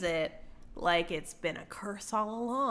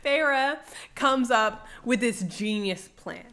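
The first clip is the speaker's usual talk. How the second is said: normal talking voice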